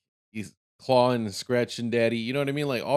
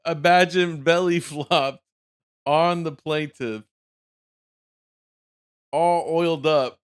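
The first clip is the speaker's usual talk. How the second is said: laughing; 6 semitones higher, 7 dB louder, slower than usual. Real, not acted. Laughing while picturing the scene.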